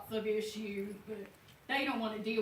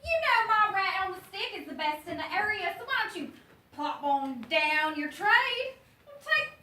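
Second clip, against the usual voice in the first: a lightly raised, brash huckster voice; gruff street vendor; 9 semitones higher, 5 dB louder than usual. Acted. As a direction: sell rats like a pushy street hawker. Abel hawks rats to kid.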